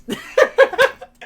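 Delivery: loud, high burst of laughter; real high-pitched cackle; laughing. Real, not acted. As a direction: crack up hard. Cracks up at his retold joke.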